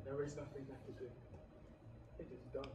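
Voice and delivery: low, soft, resigned; resigned murmur. Acted. Faustus to scholars, quietly resigned to his fate. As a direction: let the words fall, beaten.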